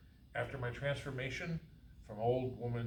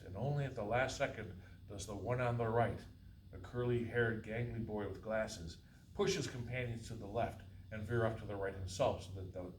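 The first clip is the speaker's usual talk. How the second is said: usual speaking voice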